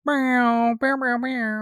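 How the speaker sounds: high squeaky meowing; cat meows. Acted. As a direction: meow like a cartoon cat. Sound effects.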